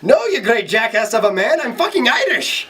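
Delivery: high, indignant Scottish-Irish brogue; comic high Scottish outburst; Scottish accent. Acted. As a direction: protest in a hammed-up Scottish accent. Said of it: "in his Scottish accent".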